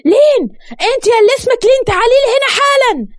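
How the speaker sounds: very high, angry yell; screaming summons. A narrator's reading. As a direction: shout for someone to come at once. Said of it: "وهي بتصرخ بصوت عالي".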